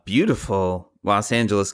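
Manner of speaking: usual speaking voice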